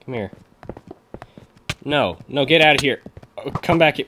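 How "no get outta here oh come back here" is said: ordinary talk, nothing marked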